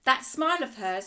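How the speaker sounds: ordinary delivery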